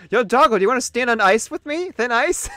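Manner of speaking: loud, high put-on British accent; mock British accent. American imagines living in UK, mock British accent. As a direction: hail a mate in bad British. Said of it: "would my accent change".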